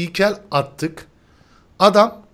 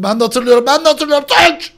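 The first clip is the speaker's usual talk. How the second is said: climbs higher and louder each time; building to a yell; 9 semitones higher, 6 dB louder than usual. Real, not acted. Host recognizing the level, building excitedly to a yell. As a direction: build from talk to a shout.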